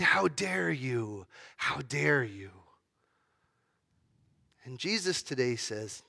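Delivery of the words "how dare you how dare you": slow, hushed, reproachful; accusing inner voice. Retold or imitated. Preacher voicing the accusing inner voice, slow and hushed. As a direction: let the inner critic creep in slowly. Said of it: "that voice that says in the back of your mind".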